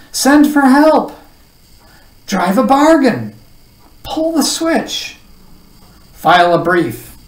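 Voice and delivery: loud, high volley of separate commands; excited shouting. A narrator's reading. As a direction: shout each order while jumping about. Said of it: "shouted the bug".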